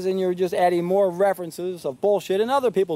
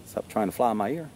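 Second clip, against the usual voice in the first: muttered aside; 8 semitones lower, 5 dB quieter than usual. Real, not acted. Aside swatting an insect.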